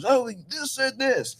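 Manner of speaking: high, drawn-out mock client voice; mimicked client. Lawyer mimicking clients who trust AI, high-pitched mockery. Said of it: "because clients are".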